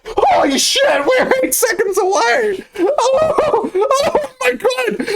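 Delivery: very high, shouting with a laugh and a cry; real shock at the countdown, screaming it out; high-pitched shriek of real disbelief; freaking out. Real, not acted. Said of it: "I started freaking out".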